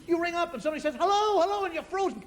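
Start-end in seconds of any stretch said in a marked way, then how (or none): 1.0-1.6 high, loud repeated phone greeting; puzzled phone answerer. Acted. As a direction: call into a silent line. Stranger answers silent phone call.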